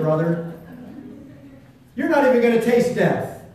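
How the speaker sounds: loud, high, driving sermon voice; preaching at full force. Acted. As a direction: preach it out, full power to the back row.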